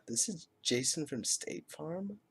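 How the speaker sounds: slow, soft, unsure and frightened; meek and hesitant, trailing up into a question; scared voice. Retold or imitated. The caller timidly introducing himself, slow and scared. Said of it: "in a very scared kind of voice".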